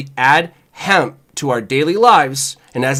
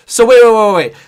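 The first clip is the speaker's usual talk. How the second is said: excited; 5 semitones higher, 5 dB louder than usual. Real, not acted. Sudden excited realisation.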